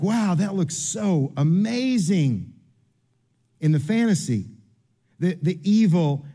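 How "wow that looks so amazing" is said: slow, dazzled "wow" at an ad; starry-eyed kid drawn in by the advert; mock wonder. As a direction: gush over the shiny package, slowly. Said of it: "And it's like".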